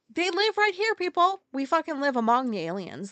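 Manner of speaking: telling viewers the aliens are here, shouting; ranting shout. Real, not acted. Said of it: "ranting to viewers".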